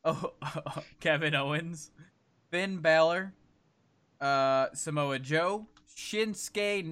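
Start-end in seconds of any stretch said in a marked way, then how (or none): none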